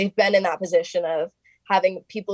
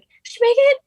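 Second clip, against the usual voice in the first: excited mom; 6 dB louder, faster than usual. Retold or imitated.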